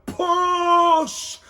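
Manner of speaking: high, loud shouted push; crowd shout. A narrator's reading. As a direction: yell it from the crowd. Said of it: "shouted the crowd".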